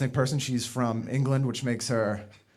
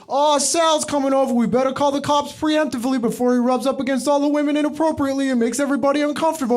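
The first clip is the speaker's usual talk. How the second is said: high, loud gossip voice rushing at the end; high put-on party gossip; mocking partygoer voice; 10 semitones higher, 3 dB louder than usual. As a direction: rattle off the warning in a put-on high voice. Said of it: "Or they'd be like".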